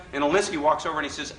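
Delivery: ordinary delivery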